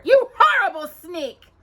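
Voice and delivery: high, loud furious shriek; screaming stepsister. Acted. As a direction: spit it at her in spite. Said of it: "she screamed".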